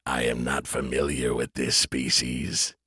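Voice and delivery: low, slow machine voice; cold mechanical AI voice; robot voice. A narrator's reading. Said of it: "a robotic voice".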